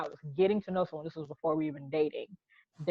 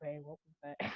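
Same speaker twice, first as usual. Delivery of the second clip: soft, quiet question; taken-aback girlfriend; 14 dB quieter than usual. Retold or imitated. Retelling her girl's first time hearing the home talk, quiet bafflement. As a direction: ask it softly, puzzled. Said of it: "when my girl heard it for the first time".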